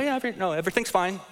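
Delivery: high, forced breezy denial; mock fine. To a friend asking if all is okay, a fake cheery high denial. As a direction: brush it off, pretending all is fine. Mock passive aggressive reply.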